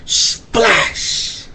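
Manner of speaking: breathy splash sound effect; story splash noise; whispered splash. A narrator's reading. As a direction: hiss out a splash. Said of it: "story sound effect".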